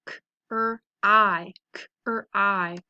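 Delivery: "cry" sound by sound, k, r, long i; segmented phonics "cry". Shown as a demonstration. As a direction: sound out "cry" twice with gaps. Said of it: "So let's practice them".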